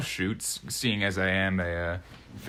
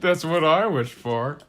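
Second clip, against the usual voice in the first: higher, louder, drawn-out echo of the duck; mimicked duck punchline; 6 semitones higher, 5 dB louder, slower than usual. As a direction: repeat the catchphrase slowly, playing the duck. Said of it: "And then the duck's like".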